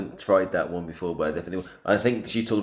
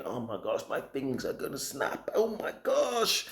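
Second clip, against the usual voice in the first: high, slow, quiet panicked whimper; mock terror that things will snap; a man half-whispering in mock panic; panicked thought; 4 semitones higher, 7 dB quieter, slower than usual. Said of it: "you may also be thinking".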